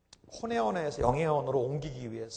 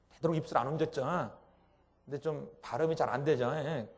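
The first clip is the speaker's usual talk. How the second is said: ordinary delivery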